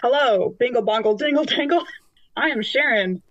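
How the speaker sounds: normal talking voice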